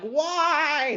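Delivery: high, drawn-out mock plea; theatrical high wail of protest; challenging God. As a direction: cry why up to the heavens. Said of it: "challenging God, I'm like".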